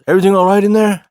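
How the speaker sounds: calling out. Retold or imitated. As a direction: imitate a concerned stranger calling in. Stranger calling into a stall.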